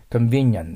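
convenient said con-VIN-nyant; nyan in convenient. Shown as a demonstration. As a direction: put a nyan into the end of convenient. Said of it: "ニャンコさんのニャンが入ります".

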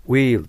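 "ウィール" with the i held long; long vowel. Shown as a demonstration. Teacher showing learners the sound, "ウィール" with long vowel. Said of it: "という発音になります".

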